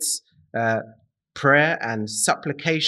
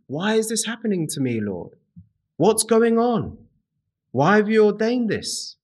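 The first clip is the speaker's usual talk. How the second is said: higher, pleading questions cried out to the Lord; a bewildered believer begging the Lord for answers; confused plea; 7 semitones higher than usual. Retold or imitated. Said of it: "Perhaps you're very confused".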